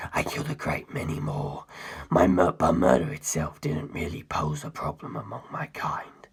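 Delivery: low, hushed, menacing whisper throughout; a cold ancient being confessing in a low whisper; ancient predator's whisper. Acted. Cars telling the narrator his history, low cold whisper.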